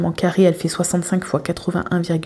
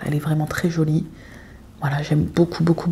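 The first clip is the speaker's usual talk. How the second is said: whispered; 2 semitones lower, 5 dB quieter than usual. Real, not acted. Admiring aside.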